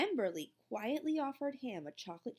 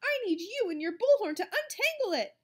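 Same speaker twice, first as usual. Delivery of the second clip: higher, louder frantic plea; urgent; 6 dB louder than usual. A narrator's reading. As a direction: hurry it out in a panic. Panicked teacher.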